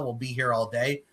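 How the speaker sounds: ordinary talk, nothing marked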